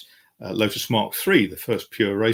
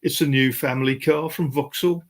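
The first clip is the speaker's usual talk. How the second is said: mock Brummie accent; 4 semitones higher than usual. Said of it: "he turned around and said".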